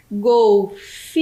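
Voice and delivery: GOLFINHO slowly split, with a long held FI; syllable by syllable. Shown as a demonstration. Teacher answers her own question for pupils, syllable by syllable. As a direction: stretch out GOLFINHO in separate syllables. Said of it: "a terceira sílaba tem três letras".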